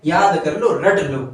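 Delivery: ordinary talk, nothing marked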